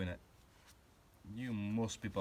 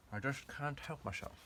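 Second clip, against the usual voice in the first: high-pitched mock secret agent voice; James Bond; 6 semitones higher than usual. Confessing a viewing habit to the audience, high-pitched spy impression. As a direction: put on a playful film-spy voice. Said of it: "keep talking like James Bond".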